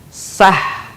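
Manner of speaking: "sah" ending in a long throat breath; breathy final H. Shown as a demonstration. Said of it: "persis seperti kita melafalkan kata". ("sah" dengan h dari tengah tenggorokan)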